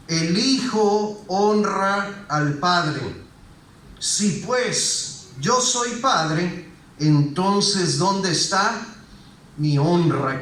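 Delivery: slow, deliberate verse reading; measured reproach, read very slowly; solemn scripture reading. Acted. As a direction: draw out each phrase slowly and weightily. Reads Bible verse aloud solemnly.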